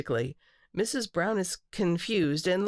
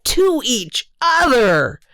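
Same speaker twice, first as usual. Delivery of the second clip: put-on high, excited child's announcement, drawn out at the end; growing excitement; 7 semitones higher, 12 dB louder, slower than usual. A narrator's reading. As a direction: build to a proud, emphatic reveal, stretching the last words. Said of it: "starting to get excited".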